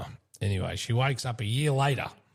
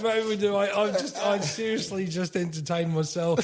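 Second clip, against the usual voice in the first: talking through giggles, pitched up; laughing; 8 semitones higher than usual. Real, not acted. Host still cracking up after his dad joke, laughing. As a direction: keep laughing as you speak. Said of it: "I'm laughing at him".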